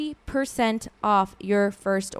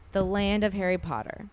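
normal talking voice

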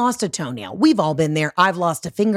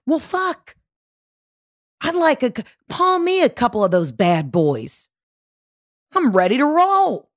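high, playful self-quote with swooping emphasis; mock-eager, swinging high; hammed-up eagerness; 7 semitones higher than usual. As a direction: ham up being raring to go. Acting out own reaction to kiwis.

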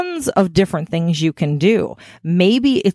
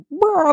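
high mock roar; playful roar; 12 semitones higher than usual. Describing hard nipples with a high playful roar. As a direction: let out a short hammed-up roar. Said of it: "you're like".

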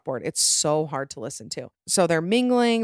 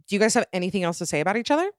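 rushed, exasperated asking; fast exasperated question; 3 semitones higher, much faster than usual. Pretend. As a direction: blurt it out quickly, fed up.

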